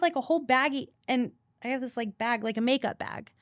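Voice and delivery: usual speaking voice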